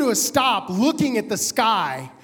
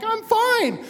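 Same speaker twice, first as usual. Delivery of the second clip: high-pitched, elated insistence; beside himself; 11 semitones higher than usual. Retold or imitated. Passenger reassuring pilot after crash, beside himself. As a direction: burst out with it, pitched way up. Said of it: "I'm beside myself".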